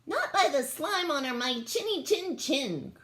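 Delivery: cheeky brave little alien; defiant alien. A narrator's reading. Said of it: "cried Nick Lewitzka".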